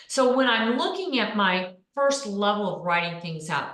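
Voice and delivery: normal talking voice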